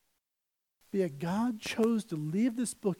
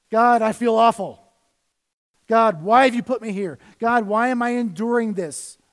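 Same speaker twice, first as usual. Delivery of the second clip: lament; 2 semitones higher, 6 dB louder than usual. Acted. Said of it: "A book of laments".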